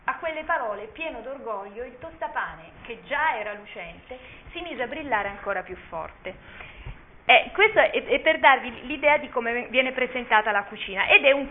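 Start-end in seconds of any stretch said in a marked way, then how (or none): 0.1-6.3 soft, hushed fairy-tale narration; story narration. A narrator's reading. Teacher reading a children's tale aloud, soft and hushed. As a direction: lull them into the story, gently.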